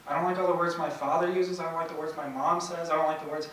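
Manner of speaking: lightly voiced boy airing his complaints; a touch of a kid's indignant list of dislikes; boy's rant. Acting out boy's rant about words.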